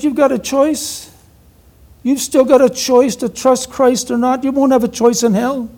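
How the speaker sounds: high-pitched imitation Cork accent. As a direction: lilt it high in a mock thick Cork accent. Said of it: "almost indecipherable Cork accent".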